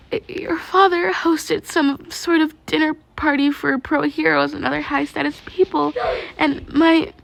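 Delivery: high, tearful, stammering confession; breaking down crying. Acted. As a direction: sob through the memory. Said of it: "She broke down then".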